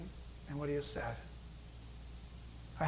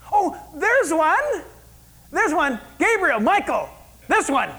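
loud, high shout of discovery; excited calling out; 10 semitones higher, 10 dB louder, slower than usual. Acted. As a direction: call out to helpers that you've found one. God voiced spotting someone, calling angels.

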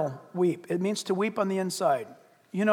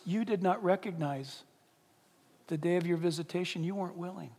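much softer, lower, grieving words; through sobbing; 4 semitones lower, 10 dB quieter than usual. Retold or imitated. Jesus weeping over Jerusalem, soft sorrowful lament. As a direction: speak it broken with sorrow, near a whisper. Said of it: "begins to speak through his sobbing".

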